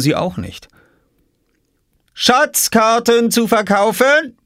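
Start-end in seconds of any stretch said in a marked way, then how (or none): 2.1-4.4 slow, very high teasing sales call; drawn-out crier's call. A narrator's reading. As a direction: hawk the maps pointedly at his sister. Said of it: "rief Oskar hinter seiner Obstkiste".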